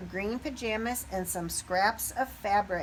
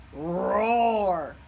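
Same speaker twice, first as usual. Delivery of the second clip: a lion's roar, hammed-up for kids; growled storybook roar; playful mock roar; 2 semitones lower, 5 dB louder than usual. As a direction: roar like a friendly lion. Lion costume roar.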